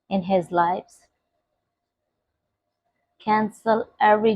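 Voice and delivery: soft, low murmured prayer. Acted. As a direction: pray quietly and intimately. Quiet, intimate prayer.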